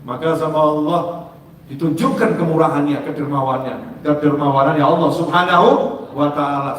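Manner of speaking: ordinary talk, nothing marked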